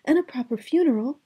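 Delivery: put-on high, bright young princess voice; cartoon princess. A narrator's reading. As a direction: play a sweet storybook princess. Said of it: "the princess said".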